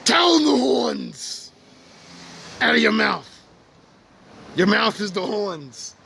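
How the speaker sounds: high, slow preacher emphasis; preaching emphasis. Real, not acted. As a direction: drag it out like a sermon point. Preaching about the horns.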